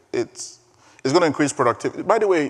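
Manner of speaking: ordinary talk, nothing marked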